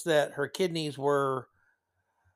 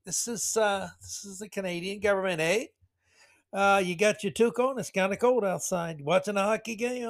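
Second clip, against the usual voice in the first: higher, cheery hammed-up Canadian accent, eh; mock Canadian caller; 5 semitones higher than usual. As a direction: play a chirpy Canadian official phoning to offer death. Mock Canadian government phone call.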